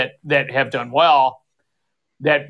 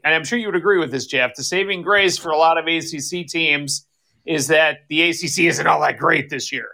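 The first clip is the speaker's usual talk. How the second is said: normal talking voice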